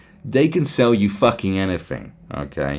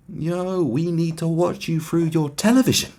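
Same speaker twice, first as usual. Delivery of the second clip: mock government voice; 7 semitones higher than usual. Voicing the government casually.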